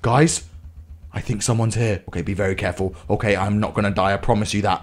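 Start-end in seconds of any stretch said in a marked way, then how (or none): 1.1-4.8 low, soft, careful murmur; wary hush. Real, not acted. Player creeping near an enemy, wary and hushed. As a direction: keep it down, enemy close by.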